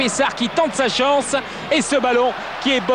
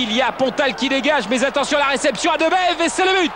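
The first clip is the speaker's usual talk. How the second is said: excited sports commentary; 3 semitones higher than usual. Real, not acted. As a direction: yell the goal as it goes in. Goal scored in live commentary.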